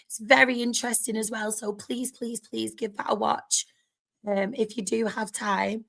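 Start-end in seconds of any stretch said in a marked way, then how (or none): none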